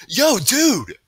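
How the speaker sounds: loud, high challenging call; sudden loud hail. A narrator's reading. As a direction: call out to confront someone. New voice calls out to bullies.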